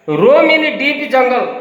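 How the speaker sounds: very slow, each word drawn out for the class; slow dictation reading. Shown as a demonstration. Teacher reading the phrase to her class, very slow and deliberate. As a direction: read it out word by word for the pupils. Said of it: "ତେଣୁ ଆମେ ଲେଖିବା roam in deep jungle".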